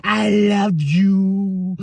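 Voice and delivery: slow, hammed-up heartbroken soap-opera threat; melodramatic. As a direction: ham it up like a betrayed lover. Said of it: "she's like".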